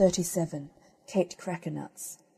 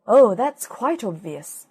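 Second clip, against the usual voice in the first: pompous wise owl; 2 semitones higher, 10 dB louder, slower than usual. Acted. Wise owl pronounces on nest.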